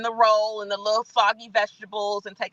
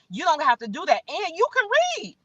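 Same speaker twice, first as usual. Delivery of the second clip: high exasperated you can read; rising in disbelief, scold them; half-mocking scold; scolding quote; 6 semitones higher than usual. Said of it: "I keep telling them".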